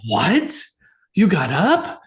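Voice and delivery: imitating everyone's shocked reaction; mock shocked. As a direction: hit it with loud disbelief.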